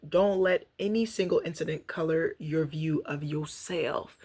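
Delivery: slow, emphatic reading from a book; reading aloud. Acted. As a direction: read each line like a lesson to listeners. Reads from daily reader.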